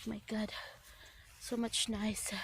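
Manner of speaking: breathless whispered awe. Real, not acted. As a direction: whisper it in wonder.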